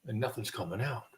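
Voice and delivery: ordinary talk, nothing marked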